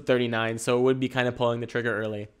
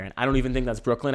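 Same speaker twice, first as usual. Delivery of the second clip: usual speaking voice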